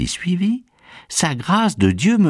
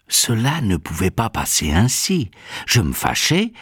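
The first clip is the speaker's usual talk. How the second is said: ordinary talk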